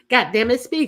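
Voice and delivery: normal talking voice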